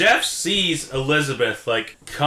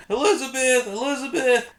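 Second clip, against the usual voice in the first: mock shouting; 9 semitones higher than usual. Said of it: "shouting up".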